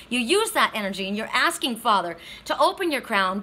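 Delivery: usual speaking voice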